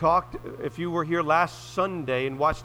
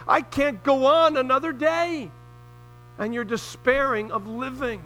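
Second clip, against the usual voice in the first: higher, louder impassioned preaching; preaching at full force; 6 semitones higher, 5 dB louder than usual. Real, not acted. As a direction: build to a fervent climax.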